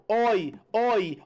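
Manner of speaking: "oi" with open o, falling; showing the sound. Shown as a demonstration. Teacher demonstrating the sound in herói, open "oi". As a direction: drop each "oi" with a wide open o. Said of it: "ó o som".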